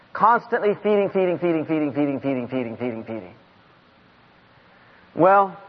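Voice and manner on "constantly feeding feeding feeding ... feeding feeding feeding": steadily sinking in pitch and volume; repetitive fade-out. Shown as a demonstration. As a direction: run the repeats on, fading away. Repeats word to show constancy.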